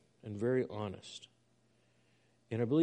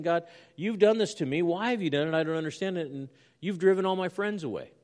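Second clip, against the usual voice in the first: raised, higher voice of earnest complaint; crying out to God; 7 semitones higher, 6 dB louder than usual. Retold or imitated. Preacher voicing the psalmist's crisis to God, crying out. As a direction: plead upward to God, louder and higher. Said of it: "he's crying out to God very genuinely".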